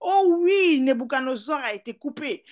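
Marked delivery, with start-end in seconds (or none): 0.0-0.8 loud, high exclamation; preaching refrain. Real, not acted. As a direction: launch the phrase like a preacher's refrain. (refrain de prédicateur, fort et aigu)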